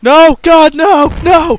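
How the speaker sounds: loud, high, protesting shout. Real, not acted. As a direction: yell in mock horror. Reacting to meowing.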